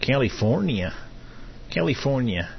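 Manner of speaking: mock Mexican Spanish accent on California; imitating a Mexican Spanish pronunciation of California; light mock Spanish accent. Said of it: "How do they say that in Mexican?".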